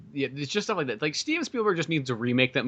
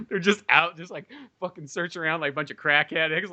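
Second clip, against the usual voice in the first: ordinary talk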